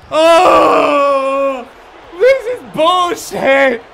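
slow loud angry shout; raging gamer. Real, not acted. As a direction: vent in fury. Rage at game.

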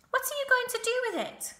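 very high, loud, quick demand; sharp-voiced rat. A narrator's reading. Templeton asking about the dud egg, sharp voice. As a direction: press for an answer, sharp and high. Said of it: "in his sharp voice".

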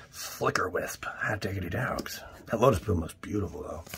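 hushed unvoiced whisper over the cards; breathy excited whisper over a pack. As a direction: whisper it like a secret find. Hushed card reveals while unboxing.

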